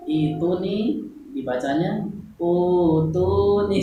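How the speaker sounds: slow demo with "utu" said in place of the correct form; slow demo of the wrong "utu" reading. Shown as a demonstration. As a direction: say "utu nih" slowly, the mistaken way. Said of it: "salah, jangan ya".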